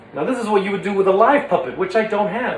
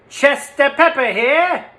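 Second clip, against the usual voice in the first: higher, slow put-on voice with a laugh; Chester voice; 10 semitones higher, 3 dB louder, slower than usual. Shown as a demonstration. Chester's trigger phrase, high character voice. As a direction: announce yourself as Chester, then laugh. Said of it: "For Chester, it's always".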